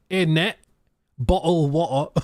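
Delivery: lightly put-on Cockney; Cockney accent. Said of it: "like, Cockney accents".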